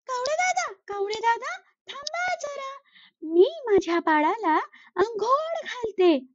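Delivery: sweet and busy, put him off gently; busy mother sparrow. Acted. Sparrow answers the crow while bathing her baby, high and sweet.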